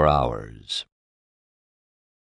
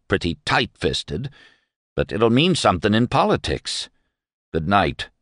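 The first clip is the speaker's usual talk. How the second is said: derisive; 6 semitones higher than usual. Acted. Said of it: "He was derisive now".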